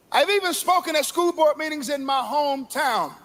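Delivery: high, ringing sermon delivery; full-force preaching. Acted. Preacher warning the congregation of dangerous times, ringing. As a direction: preach the last days with fire.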